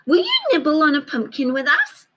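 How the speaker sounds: high, small friendly mouse. Acted. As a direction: ask sweetly like a tiny mouse. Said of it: "said Mouse".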